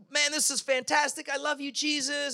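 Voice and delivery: high-pitched, gushing praise; acted worship fervor. Worshipper to Jesus during the service, acted high-pitched rapture. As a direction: gush like an overjoyed worshipper. Acting out fervent worshippers in church.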